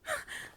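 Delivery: a sudden high startled gasp; snapped out of a daydream; sharp gasp. Acted. Sharp gasp ending the flashback.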